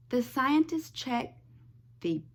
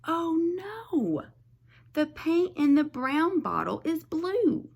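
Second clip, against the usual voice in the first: alarmed; 6 semitones higher, 4 dB louder than usual. Acted. Character alarm.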